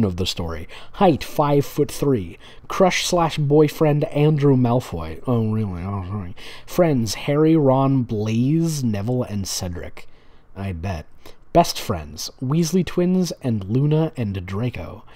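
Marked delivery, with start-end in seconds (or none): none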